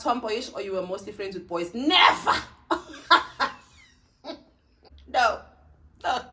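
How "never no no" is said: louder, higher never then a laugh; amused loud protest; laughing denial. Real, not acted. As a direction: shout it off laughing. Denying being a tomboy.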